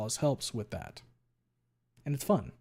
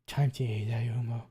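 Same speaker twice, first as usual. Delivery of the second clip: creepy whisper. Pretend. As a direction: hiss it under your breath. Whispered creature line.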